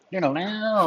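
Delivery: high, wailing mock guitar riff; imitated electric guitar. Game master jokes about hang-up tones, imitating electric guitar. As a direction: sing out a cartoon guitar twang. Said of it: "Electric guitar hang-up".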